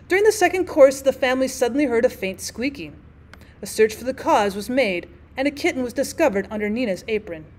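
ordinary delivery, nothing marked

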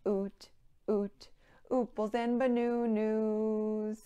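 sung with oo in place of each vowel; U sound verse. Shown as a demonstration. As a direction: sing "oot, ooples and banoonoo" with every vowel as long oo. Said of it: "Now with a U sound".

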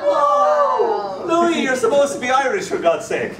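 high, loud mock outrage; whooping protest that drops into a laugh; indignant outburst. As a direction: cry out in comic protest, breaking into laughter. Outraged young fan scolds recorded poet.

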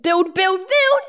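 rising, loud urgent cries; urgent shouting. Real, not acted. Urgent building.

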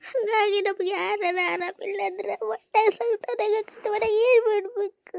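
slow, high tearful wail; crying. Acted. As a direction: break into weeping as you explain. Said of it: "ನಾನ ನಿನ್ನ ಅಳಿಸ್ದಂಗ ಆತಲ್ಲ". (ಅಳುತ್ತಾ ಎಳೆದು ಮಾತಾಡುವುದು)

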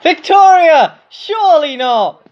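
loud, high cry falling away; shocked disbelief. Real, not acted. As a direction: call the name in alarm, then disbelief. Disbelief at near elimination.

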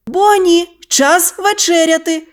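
high-pitched mother's call. A narrator's reading. Mother calls her daughter to supper, high singsong call. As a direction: call the child in, pitched high without shouting. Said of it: "гукнула дівчинку мама".

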